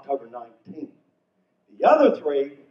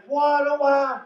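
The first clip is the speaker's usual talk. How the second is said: panicked wife; 10 semitones higher, 5 dB louder, slower than usual. Retold or imitated. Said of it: "before she could say".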